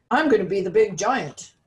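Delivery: loud proud boast about playing the giant; boastful boy. A narrator's reading. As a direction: claim the giant part with swagger. He wants to be the big cheese.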